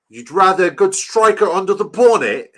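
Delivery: loud, high mock English accent. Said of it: "this is a good English accent".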